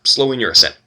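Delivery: usual speaking voice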